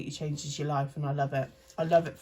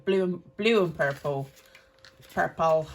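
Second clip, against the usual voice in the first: louder, badly faked Scottish accent; 6 dB louder than usual. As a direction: have a clumsy go at Scottish. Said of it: "the rubbishest Scottish accent I've ever heard".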